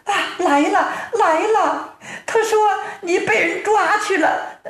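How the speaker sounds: high-pitched wailing wife voice; storyteller's acted weepy wife; tearful wife. A narrator's reading. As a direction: greet your husband in a high, fretful lament. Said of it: "正在屋中掉眼泪呢".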